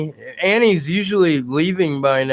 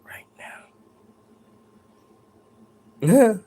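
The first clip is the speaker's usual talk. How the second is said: drawn-out with a lift on now; imitating the voice; 6 semitones higher than usual. Ghost hunter showing the real whisper's shape. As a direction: imitate how the ghost really said it. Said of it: "It was more like".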